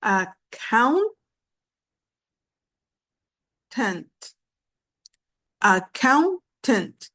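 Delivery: "accountant" slowly split into a-coun-tant; syllable by syllable. Shown as a demonstration. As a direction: pause between each syllable of "accountant". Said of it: "Here we have three syllables too".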